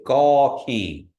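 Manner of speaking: Gaw King loud with a nasal King; nasal ending. Shown as a demonstration. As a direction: let King ring through the nose. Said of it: "You're just saying king".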